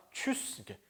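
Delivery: tschüss said softly, almost breathed; hushed model of tschüss; quiet tschüss demo; soft spoken demo. Shown as a demonstration. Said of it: "표준은 두 가지로".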